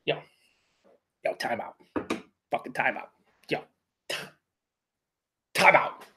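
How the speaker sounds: soft stunned "time out"; overwhelmed, regrouping. Real, not acted. After the first sip he asks for a moment, stunned and hushed. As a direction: pull back, quietly stunned by the sip. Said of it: "I need to think and just gather my thoughts".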